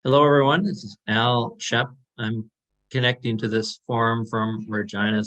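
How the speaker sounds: ordinary talk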